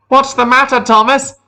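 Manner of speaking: high-pitched, teasing shout as James passes; James calling out. A narrator's reading. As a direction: call out high and loud to a friend. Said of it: "he called".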